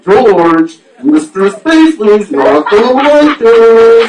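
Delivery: loud, high, slow robot computer voice; Rudy impersonation. Retold or imitated. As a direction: play a worried little cartoon computer. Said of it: "that impersonation".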